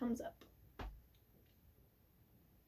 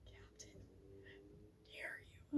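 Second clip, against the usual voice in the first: breathy whisper of betrayal; hushed defiance; 13 dB quieter than usual. Retold or imitated. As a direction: hiss the challenge under your breath. Eurylochus voiced defying his captain.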